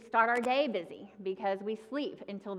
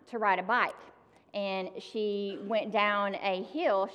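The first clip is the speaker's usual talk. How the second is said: ordinary delivery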